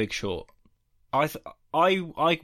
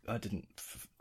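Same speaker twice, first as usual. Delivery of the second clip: whisper; 3 semitones lower, 15 dB quieter than usual. Real, not acted.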